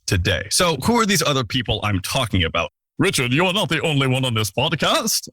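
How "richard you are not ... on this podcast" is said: higher mock-objecting listener voice; hammed-up imaginary listener, pitched up; silly put-on voice. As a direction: interrupt yourself in a silly voice. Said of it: "never use that voice again".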